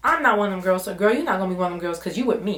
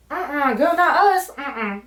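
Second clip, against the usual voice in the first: put-on high, loud uh-uh girl, not us; high-pitched diva no-way; sassy refusal; 7 semitones higher, 5 dB louder, slower than usual. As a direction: shut it down with big attitude. Refusing ring light plan.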